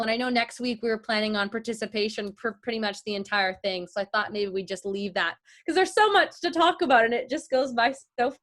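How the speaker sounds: normal talking voice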